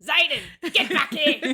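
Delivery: high, mock-scolding call to a child; mock mum yell. Mum scolding a child in the supermarket, high mock yell. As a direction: call the kid back like a harried mum. Mum yelling at kid in shop.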